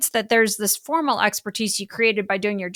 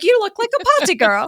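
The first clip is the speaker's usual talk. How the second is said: much higher, mocking British coach accent; 10 semitones higher, 4 dB louder, faster than usual. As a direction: mimic the posh British speaking coach, pitched way up. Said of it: "You know, she's British".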